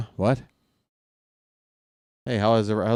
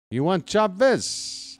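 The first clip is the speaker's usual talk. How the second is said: higher, very slow sing-song tempting; even slower, higher pitch; 9 semitones higher, slower than usual. Pretend. GM teasing a rival with Chavez, high slow sing-song. Repeats the pitch, more drawn out.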